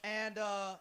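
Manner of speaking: heated jab at the opposing fans; a little heated. Real, not acted. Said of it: "I'm sorry, I'm getting a little heated".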